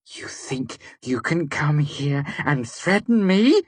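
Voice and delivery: slow, whispered hiss. A narrator's reading. Devi hissing at the narrator, enraged. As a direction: hiss it through her rage. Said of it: "Devi hissed".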